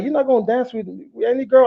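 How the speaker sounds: high, mock-incredulous buddy voice; a touch of a friend ribbing him; teasing friend.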